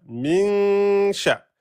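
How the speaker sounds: min sha, n held two beats; two-beat noun. Shown as a demonstration. Teacher showing students the two-beat noun. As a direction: stretch the n of "min" before "sha". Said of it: "avant de prononcer le sha".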